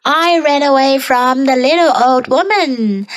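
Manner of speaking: ordinary delivery, nothing marked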